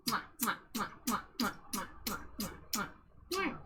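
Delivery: soft smooches, one after another; kissing sounds. Real, not acted. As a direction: blow a string of little kisses. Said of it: "to give you smooches".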